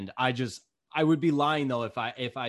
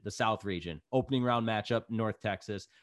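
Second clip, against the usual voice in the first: ordinary delivery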